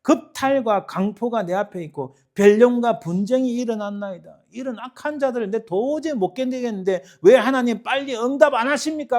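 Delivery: high, loud desperate complaint to God; agitated protest. Acted. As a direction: plead loudly as if unable to bear it. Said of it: "하고 일어나서 막 방방 뛰면서".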